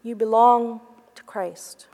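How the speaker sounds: whispered like a memory echoing. Retold or imitated. Mary recalls her supervisor's words, a hushed echoing whisper. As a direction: breathe the words as if remembered inside. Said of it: "echoed loudly in her heart".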